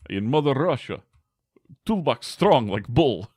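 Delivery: high-pitched mock Russian accent; bad Russian accent. Player joking about a strong toolbox, bad Russian accent. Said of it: "My Russian accent is not great".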